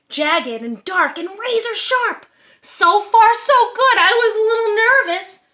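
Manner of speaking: ordinary delivery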